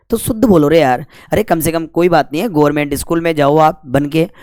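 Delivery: ordinary talk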